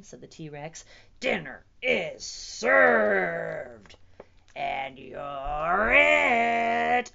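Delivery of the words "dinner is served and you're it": very slow, booming threat; menacing T-Rex. A narrator's reading. T-Rex claims Stanley as dinner, slow booming threat. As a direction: stretch each word into a loud growing menace.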